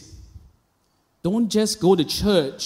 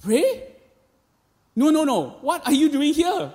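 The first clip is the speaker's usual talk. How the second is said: much higher incredulous pray, then pressing again; teacher who can't believe it, high and insistent; teacher hearing her rowdy student came to pray, high incredulity; 9 semitones higher than usual. Retold or imitated. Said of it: "looked at me and said".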